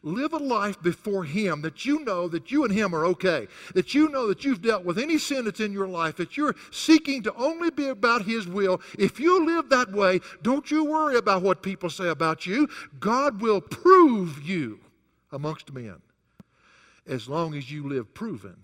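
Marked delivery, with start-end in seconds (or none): 0.0-12.7 louder, higher preaching build; rising sermon fervor; forceful preaching. Acted. 13.0-14.8 very slow, loud proclamation of prove; God will prove you, each word held long; slow drawn-out emphasis. Acted. Refrain repeated, much slower.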